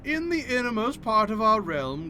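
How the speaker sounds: stately, theatrical proclamation building to a loud cry; grand deity challenger. Acted. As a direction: summon a mortal to battle, swelling to full volume. Voices deity issuing challenge.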